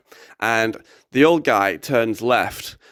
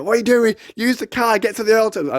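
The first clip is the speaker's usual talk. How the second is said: imitating an agitated friend, much higher; high, urgent retold objection; frantic passenger demanding, re-enacted; panicked protest; 10 semitones higher, faster than usual.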